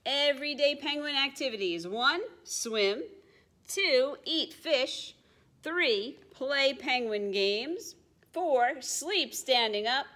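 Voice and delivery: put-on lively read-aloud for small children; story narration. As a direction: read it brightly to little listeners. Reading a picture book aloud.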